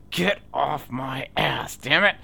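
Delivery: slow, emphatic mock road rage; retold angry outburst. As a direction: re-enact snapping at a tailgater, drawn out. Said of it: "I would just get so angry".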